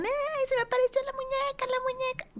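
high-pitched mock frightened squeal; niñas asustadas. Imitating girls who saw the doll in the school bathroom, mock-frightened. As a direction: squeal it like scared schoolgirls, playfully.

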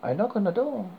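ordinary delivery

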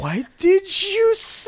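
high, drawn-out mock disbelief; retold disbelief. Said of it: "I was like".

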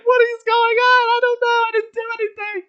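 very high, loud wail; hammed-up crying protest; mock sobbing. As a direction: wail innocence like a hysterical child. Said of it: "just sobbing".

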